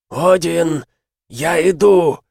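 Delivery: high, loud gurgling dying cry; gurgled. Acted. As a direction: gurgle it through blood, shouting. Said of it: "пробулькал Дрэнг".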